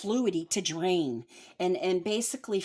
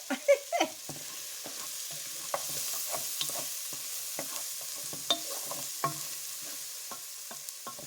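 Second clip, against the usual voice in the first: high airy giggle; a silent wheezing laugh; breathless giggle; 4 dB quieter than usual. Real, not acted. Cook cracking up at her own joke, breathless giggle.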